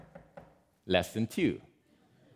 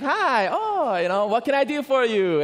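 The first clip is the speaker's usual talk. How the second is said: high, bright greeting at the door with a laugh; high-pitched friendly neighbor, laughing; cheerful neighbor; 11 semitones higher, 5 dB louder than usual. Retold or imitated. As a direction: greet her like a delighted American neighbor. Neighbor greets Korean grandmother.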